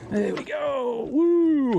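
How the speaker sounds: high, loud whoop of joy; triumphant shout. Real, not acted. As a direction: cheer as the fish lands. Landing the fish.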